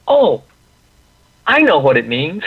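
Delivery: high, loud burst of delighted understanding; happy "oh!" leaping up in pitch; excited, very happy. Retold or imitated. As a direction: imitate someone thrilled to suddenly get it. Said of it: "excited or very happy".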